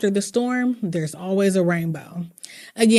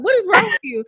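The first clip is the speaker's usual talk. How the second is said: husband's question; 11 semitones higher, faster than usual. Retold or imitated. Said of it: "He's like".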